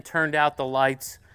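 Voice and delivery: ordinary talk, nothing marked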